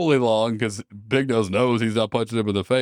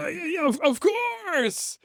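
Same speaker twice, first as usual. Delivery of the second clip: terrified answer; 3 dB quieter, slower than usual. Acted. Dad answering in terror.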